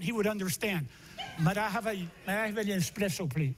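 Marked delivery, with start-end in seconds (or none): 1.4-3.5 fast put-on Spanish accent on the order; mock Spanish accent. Boys ordering espresso in mock Spanish accent. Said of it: "if we said it in a Spanish accent".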